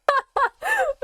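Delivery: high flustered giggle; giddy laugh. Real, not acted. Streamer flustered by a character's voice, high giggle. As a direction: giggle like a blushing fan. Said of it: "You are blushing through the screen".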